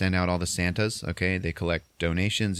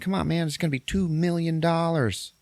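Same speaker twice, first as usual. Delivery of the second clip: mock coaxing; 10 semitones higher than usual. Retold coaxing.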